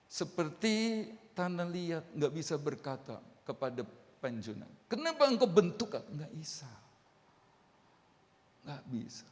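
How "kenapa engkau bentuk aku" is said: clay's protest. Acted. Clay questions the potter.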